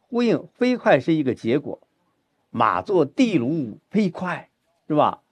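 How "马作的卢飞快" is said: 的卢 weighted, slow line; slow, lean on 的卢; stress on 的卢. Shown as a demonstration. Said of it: "我觉得应该是放在的卢上". (重音放在“的卢”上，放慢)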